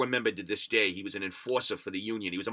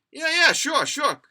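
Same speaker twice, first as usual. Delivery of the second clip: mock eager agreement; 8 dB louder, slower than usual. His own eager reply to cops.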